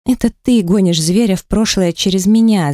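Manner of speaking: usual speaking voice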